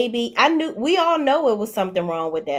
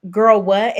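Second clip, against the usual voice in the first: surprised; 7 dB louder than usual. Real, not acted. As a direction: blurt it out in disbelief. Reacts to sister's clip.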